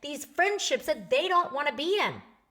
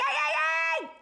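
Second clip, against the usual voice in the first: mock yell; faster than usual. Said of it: "it's not gonna be like".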